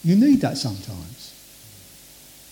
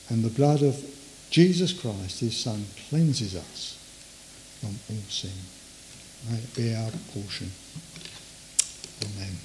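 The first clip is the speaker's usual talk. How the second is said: fading prayer; 6 semitones lower, 8 dB quieter than usual. Acted. Closing prayer fading soft.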